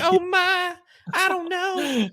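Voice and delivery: high falsetto fluttery little lady; mock damsel. Teasing cohost, high falsetto damsel voice. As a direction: squeak it like a fainting belle. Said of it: "you could've worn them blonde curls, you know, be like".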